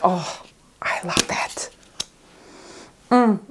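soft breathy whisper, slow; whispered delight. Real, not acted. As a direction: savour the scent in a hush. Sniffing deodorant scent.

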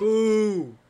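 booing loudly at the screen; a loud heckling boo. Real, not acted. Booing the game speech.

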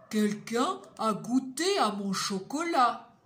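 grosse voix bourrue de papa ours, plus forte. Acted. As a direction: jouer le papa ours mécontent. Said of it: "Papa Ours dit". (Papa Ours grogne en rentrant, voix forte et bourrue)

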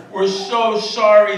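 loud, slow pleading apology; pleading students. Retold or imitated. Students begging the professor, slow loud plea. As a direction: beg like sorry students.

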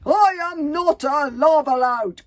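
high, slow, full-volume shout; drawn-out, emphatic yell of denial; calling as loudly as possible. A narrator's reading. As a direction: bellow it through muffling headgear. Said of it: "called the man as loudly as he could".

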